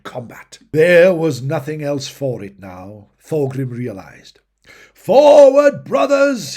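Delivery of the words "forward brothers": a high, loud battle cry to his troops; shout. Acted. Dwarf king urging his brothers into battle, full shout. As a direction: roar it out to rally the charge. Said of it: "he shouted".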